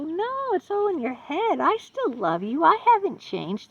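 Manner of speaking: high, sing-song imitation of a lover brushing her off; mock sweet reassurance. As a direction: sweetly deny everything, mocking him. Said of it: "That's what Dick said to me".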